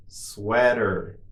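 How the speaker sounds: drawn-out word for learners; slow model. Shown as a demonstration. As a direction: say it slowly and evenly for repetition. Teacher models word for repetition.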